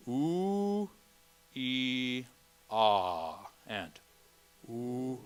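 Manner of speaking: sustained pure vowels Oo, ee, ah; pure Latin vowels. Shown as a demonstration. Choir director models pure Latin vowels for singers. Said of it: "repeat after me".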